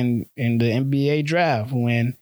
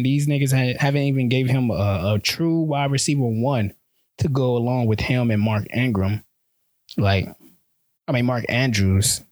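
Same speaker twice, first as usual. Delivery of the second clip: ordinary talk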